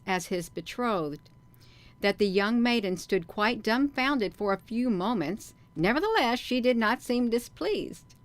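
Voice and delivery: lively, emphatic read-aloud with swinging pitch; story narration. A narrator's reading. Reads the book aloud.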